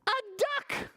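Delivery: very high, drawn-out storybook surprise; amazed reveal. A narrator's reading. Reader showing children what vet pulled out, amazed. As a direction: announce the find in high amazement.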